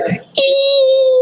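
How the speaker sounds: long very high squeal of plane tyres on the runway; plane wheels screech. Retold or imitated. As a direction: shriek it like landing wheels. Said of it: "That's the plane wheels hitting the ground".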